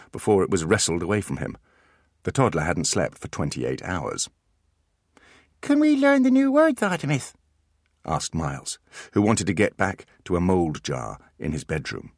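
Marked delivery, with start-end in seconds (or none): 5.6-7.3 put-on high small-boy voice; a young boy asking eagerly; child voice. Miles asks Artemis, in a high child voice.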